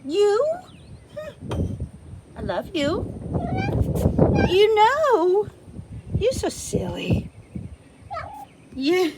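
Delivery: high, sing-song pet talk. Real, not acted. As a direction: coo at the cat in a high voice. Talking to pet cat.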